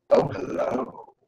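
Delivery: very slow, hushed, drawn-out hello; contrasting knowing greeting. Shown as a demonstration. Teacher showing a different tone into the camera, slow and suggestive. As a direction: stretch it out softly, loaded with meaning. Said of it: "Vastly different interpretations".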